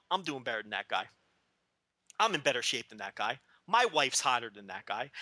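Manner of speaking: low, smug thinking to yourself; smug inner voice. Retold or imitated. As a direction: mutter it as a smug private thought. Inner thought of reunion attendees.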